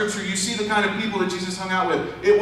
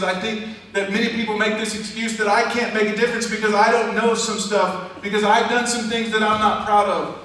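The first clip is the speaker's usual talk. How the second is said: raised, ringing pulpit voice; preaching at full force; 5 semitones higher, 3 dB louder than usual. Acted. Preacher urging the church to take friends home, full force. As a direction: drive it home to the congregation.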